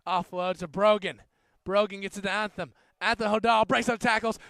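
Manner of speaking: rugby commentary, rising with each run; live play-by-play. Acted. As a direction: call the match as it happens.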